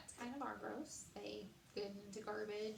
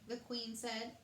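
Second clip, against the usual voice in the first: usual speaking voice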